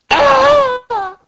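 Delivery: high, loud cry of pain; hammed-up high wail; acted scream. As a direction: let out a high cartoon scream. Acted scream in manga factory.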